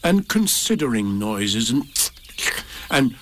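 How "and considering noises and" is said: plain reading voice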